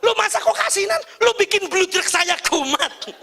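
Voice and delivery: high, mock-angry whine at the wife; angry complaint. Husband complaining the food is too salty, high. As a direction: complain like a hot-tempered husband. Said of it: "Jangan langsung marah, bilang begini".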